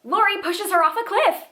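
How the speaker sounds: high-pitched disbelieving reveal; incredulous. Real, not acted. Reviewer revealing the sister's shocking act, incredulous. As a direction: drop the twist with pitch jumping right up. Shocking twist in plot recap.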